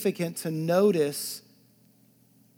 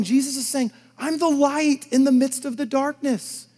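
loud, high, ringing preacher's voice; preaching at full force; 9 semitones higher, 9 dB louder than usual. Acted. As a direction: proclaim it to the whole church.

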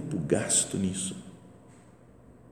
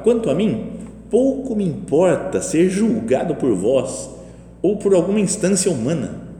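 bold proclamation; 7 semitones higher, 6 dB louder than usual. Retold or imitated. Preacher quotes Saint Paul to the faithful, boldly.

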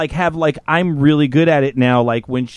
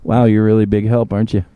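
lower, dry sarcasm; mock yourself deadpan; sarcastic inner voice; 7 semitones lower than usual. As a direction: inner voice mocking his failure, dry sarcasm. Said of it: "in the back of my mind".

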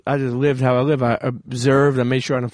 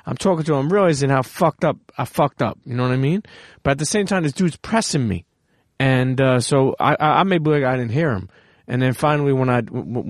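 ordinary talk

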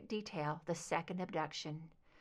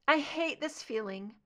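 raised, trembling angry voice; shaking with anger; 6 semitones higher than usual. A narrator's reading. Scully under hypnosis, to Mulder, shaking with anger. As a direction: let the anger shake through the words. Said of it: "Her voice shook with anger".

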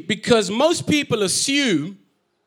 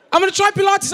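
much higher and louder, eager resolve; excited; 11 semitones higher, 4 dB louder, faster than usual. Retold or imitated. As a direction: blurt the plan out, thrilled with yourself. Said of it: "repeats the resolution, excited".

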